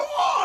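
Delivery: very high-pitched "oh" cry; imitated commentator scream. Imitating the lucha commentator's screaming, high excited cry. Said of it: "the guy's screaming".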